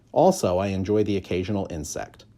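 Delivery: ordinary delivery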